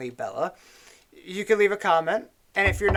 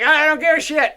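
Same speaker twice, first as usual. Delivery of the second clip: mock defiant yell; 8 semitones higher, 7 dB louder than usual. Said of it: "be like".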